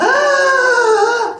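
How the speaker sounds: loud, high desperate gasp; big dramatic gasp; gasp for breath. Acted. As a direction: suck in air like someone suffocating. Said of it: "panting for breath".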